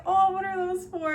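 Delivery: high, loud eager question; asking the bartender about rubber ducks; curious surprise. Retold or imitated. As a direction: ask it wide-eyed. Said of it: "And I was like".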